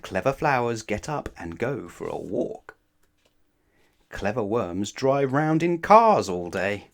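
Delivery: plain reading voice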